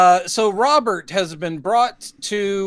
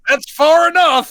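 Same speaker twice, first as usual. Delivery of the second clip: high, loud, drawn-out "stop right there"; mock halt call; 12 semitones higher, 5 dB louder than usual. Podcaster voicing the camera stopping at the chin, high mock halt call. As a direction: call a comic halt, slow and raised.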